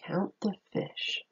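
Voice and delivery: softly whispered instruction. Acted. Teacher whispers to children to count the fish. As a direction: whisper it like a secret.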